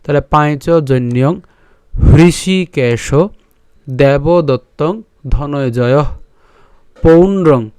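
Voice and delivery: slow, careful verse words with each syllable apart; teacher modeling verse pronunciation; slow syllable demo. Shown as a demonstration. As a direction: model the Sanskrit slowly for students. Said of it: "teaching slow recitation".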